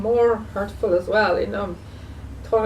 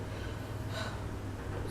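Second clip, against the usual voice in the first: sigh; 17 dB quieter than usual. Real, not acted. Grieving owner apologizes after sigh.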